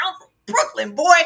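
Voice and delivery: sassy Brooklyn version. Retold or imitated. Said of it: "I thought she was gonna say".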